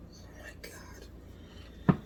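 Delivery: awed breathless whisper. Real, not acted. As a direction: breathe it out in awe. Tasting food, awed reaction.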